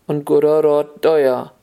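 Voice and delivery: ordinary delivery, nothing marked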